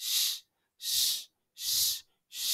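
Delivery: "স" as separate long shushing sounds; SH sound drill. Shown as a demonstration. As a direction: repeat "স" as a sustained shh. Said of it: "এসএইচ তে স".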